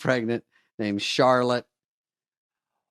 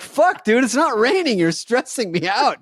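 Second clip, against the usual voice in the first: high, loud mock exasperation; exasperated outburst; 11 semitones higher, 5 dB louder than usual. Voicing drivers annoyed by dry wipers, exasperated. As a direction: complain loudly, pitched way up.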